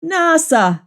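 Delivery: normal talking voice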